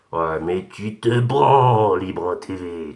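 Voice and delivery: slow, stretched-out jeer; drawn-out taunt. Real, not acted. Ranter signs off against rival YouTuber, drawn-out taunt. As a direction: draw the words right out to taunt.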